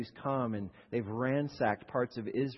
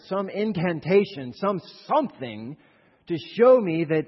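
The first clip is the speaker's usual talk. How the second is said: loud, high, indignant rant; enraged outburst; 5 semitones higher, 9 dB louder than usual. Retold or imitated. Preacher voices Naaman raging at the prophet's servant, furious. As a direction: rage at the insult of a dirty little river. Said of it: "flies into this rage".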